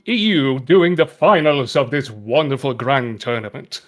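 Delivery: booming, slow, grand heroic knight; valiant warrior voice. Pretend. [name] hams up a grand warrior for the stream, booming heroic voice. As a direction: proclaim it like a noble warrior at a tournament. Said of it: "shall we go with the valiant warrior indeed?".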